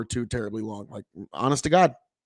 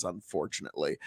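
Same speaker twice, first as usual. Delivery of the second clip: resigned, low; 10 semitones lower, 9 dB quieter, faster than usual. Real, not acted. Repeated word, then low resigned echo.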